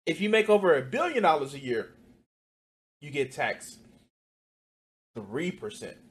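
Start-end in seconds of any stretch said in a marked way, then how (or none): none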